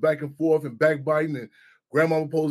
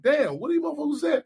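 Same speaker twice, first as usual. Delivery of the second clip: high-pitched, retold disbelief; mock-astonished, high-pitched question; incredulous reaction; 9 semitones higher than usual. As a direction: act out your baffled reaction, pitch shooting up.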